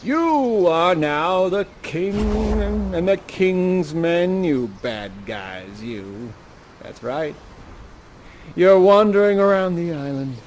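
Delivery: slow, drawn-out storyteller voice setting the scene; story narration. A narrator's reading. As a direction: cast the listeners into the story, slowly. Narrator casts the audience as king.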